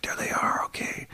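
hushed, secretive whisper; spying whisper. Retold or imitated. Dads secretly trailing sons, whispering. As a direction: whisper it as if hiding. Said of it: "like, spying on him".

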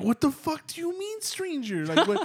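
very high-pitched retold bewilderment; incredulous outburst. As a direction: act out freaked-out disbelief, breaking into a laugh. Baffled reaction to sleep talk, retold.

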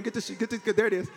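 ordinary talk

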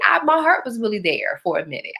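ordinary delivery

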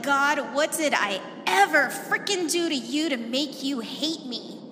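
loud, raw cry to God; desperate prayer. Retold or imitated. Woman praying in desperation over infertility, loud and raw. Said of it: "my prayer sounded more like".

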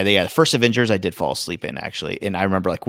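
ordinary delivery

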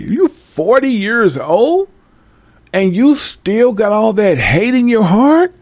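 high, slow, outraged disbelief; incredulous high rant. Acted. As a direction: scold him like you can't believe it, slowly.

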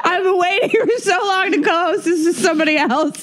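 high, laughing banter; joking through laughter. Real, not acted. Co-host joking about leaving, laughing as she talks. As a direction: tease while still laughing.

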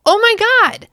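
high, loud burst of excitement; excited gasp. Real, not acted. Retelling the battle to viewers, a loud high excited outburst. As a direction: blurt it out, thrilled. Excited reaction to falling tree.

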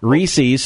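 Reese's said as REE-seez; Reese's with two long ee vowels; common mispronunciation. Shown as a demonstration. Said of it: "like most of us say".

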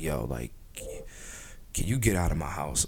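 dropped low, flat irritated ask; a low, fed-up request to leave. Retold or imitated. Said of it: "I'll be like".

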